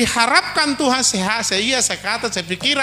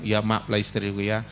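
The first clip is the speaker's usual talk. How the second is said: low, quiet husband's apology; hushed tender apology to wife; soft apology; 11 semitones lower, 4 dB quieter than usual. Acted. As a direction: say sorry gently and low. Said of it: "Dia bilang".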